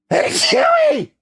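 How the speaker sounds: big explosive sneeze, real. Real, not acted. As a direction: sneeze forced out by pepper up the nose. Second pepper sneeze.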